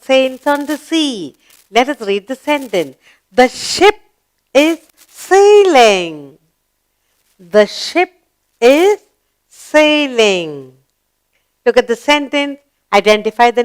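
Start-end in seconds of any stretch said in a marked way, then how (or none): 3.3-6.4 drawn-out, emphatic word-by-word reading; slow model reading. Shown as a demonstration. Teacher reading the ship sentence to pupils, slow model reading. 7.4-10.8 word-by-word with clear pauses; slow model reading. Shown as a demonstration. Teacher repeating the ship sentence to pupils, slow model reading.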